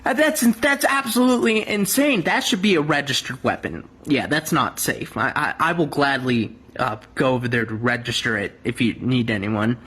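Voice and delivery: a lightly voiced Karen, pitched low. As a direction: play a prim, proper caller reporting shots.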